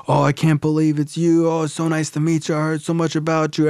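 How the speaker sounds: high-pitched, imitated fuss over a guest; gushing greeters. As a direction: gush like delighted well-wishers. Meeting crowd gushes while hugging dad.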